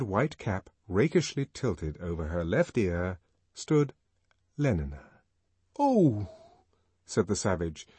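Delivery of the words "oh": high, stunned "Oh!"; a high cry of shock; struck by a blow. A narrator's reading. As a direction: gasp it as if hit hard. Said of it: "as though someone had struck him a heavy blow".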